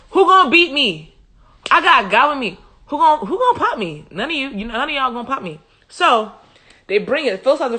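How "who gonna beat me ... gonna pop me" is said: cocky high-pitched taunt, loud at first; excited bragging. Acted. Israelite sure of God's backing, excited cocky bragging. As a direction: dare anyone to take you on. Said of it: "They're excited because they're like".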